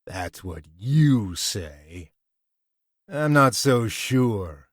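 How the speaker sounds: slow, sly and knowing; sly doubt. A narrator's reading. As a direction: let the suspicion drip. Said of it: "grinned slyly".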